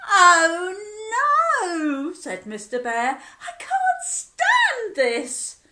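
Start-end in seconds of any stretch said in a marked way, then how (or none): 0.1-2.1 high, loud, crying wail; exasperated wail. Acted. Sleepless bear exasperated. 3.4-5.6 very high, loud, slow and wailing; desperate bear. Acted. Sleepless bear exasperated.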